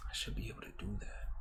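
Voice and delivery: quick whispered self-talk; fast whisper to self. Real, not acted. Still puzzling over the upload, fast whisper. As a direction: rush it out in a whisper. Muttering to herself.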